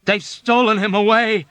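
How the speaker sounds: high loud shout. A narrator's reading. Dunbar shouting back at Yossarian. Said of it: "Dunbar shouted back".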